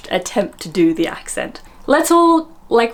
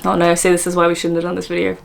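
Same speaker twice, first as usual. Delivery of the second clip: ordinary talk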